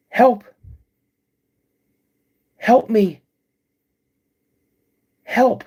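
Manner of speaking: high, loud cries for help in a woman's voice; female voice. Retold or imitated. Creature mimicking a woman crying for help, loud and high. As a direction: call out like a woman in distress, pitched high. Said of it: "in a female's voice".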